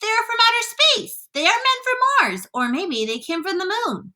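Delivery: high, wild crowd speculation; excited shouting. A narrator's reading. Onlookers guess where the creatures come from, shouting excitedly. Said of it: "they shouted".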